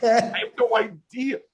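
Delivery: high, slow, helpless laughing; real laughter breaking into the words. Real, not acted. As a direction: laugh your way through it, stretched out. Cracking up at own confusion.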